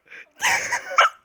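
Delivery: squealing giggle; high nervous laugh. Real, not acted. Laughs while scared.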